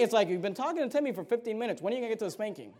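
usual speaking voice

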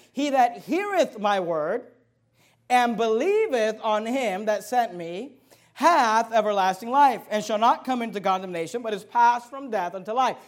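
slow, weighty recital of a Bible verse; emphatic scripture reading. Acted. Preacher reads Jesus' words to the congregation, slow and emphatic. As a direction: declaim the verse phrase by phrase.

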